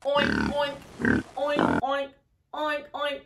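playful oinking; pig oinks. Shown as a demonstration. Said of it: "What sound does a pig make?".

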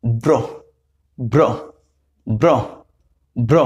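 ব্র as one fused br cluster, repeated; teacher drilling ব্র; blended br sound. Shown as a demonstration. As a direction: drill ব্র four times, crisp. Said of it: "এর সংযুক্ত উচ্চারণ কি হবে".

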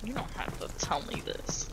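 unvoiced whispered echo. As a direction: whisper the line back.

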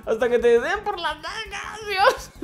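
high-pitched, gleeful battle taunts; euphoric taunting. Real, not acted. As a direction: taunt the enemy, high and giddy with laughs. Taunting the boss while firing. (provocación eufórica, aguda y entre risas)

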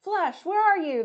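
high, loud call over the speaker; chief hollering. Acted. Chief calls Flash over the speakers, hollering. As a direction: holler for the truck. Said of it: "the chief hollers".

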